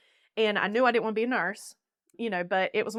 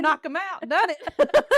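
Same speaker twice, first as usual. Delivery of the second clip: pumped-up; 8 semitones higher, 5 dB louder, slower than usual. Retold or imitated. Retold pep talk to self.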